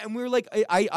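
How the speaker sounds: ordinary delivery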